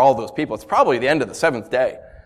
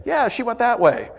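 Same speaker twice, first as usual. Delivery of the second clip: knowing sly lie; 10 semitones higher than usual. Shown as a demonstration. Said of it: "The right thing to do is to say".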